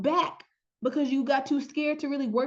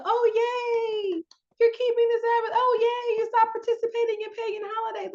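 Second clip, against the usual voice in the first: much higher, sing-song mock praise; mock cheering; 10 semitones higher than usual. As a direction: overdo cheering a toddler. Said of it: "You don't get, like".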